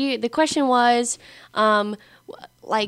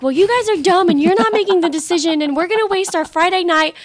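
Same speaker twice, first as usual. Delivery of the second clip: high-pitched nagging rant, mock yell; imitated yelling; 6 semitones higher than usual. Best friend complains about a wasted Friday night, mock yelling. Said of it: "yelling at me".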